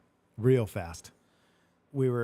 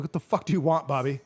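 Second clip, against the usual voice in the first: sharp, raised, irritated shout at a friend; retold angry yell; 4 semitones higher, 5 dB louder than usual. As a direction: snap at him like you've lost patience. Retold angry outburst at Bobby.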